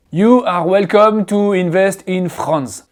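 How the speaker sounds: slow English with French Rs in "are" and "France"; mimicked French accent. Shown as a demonstration. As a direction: parody a French speaker's English, word by word. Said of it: "c'est pas du tout le même R qu'en français".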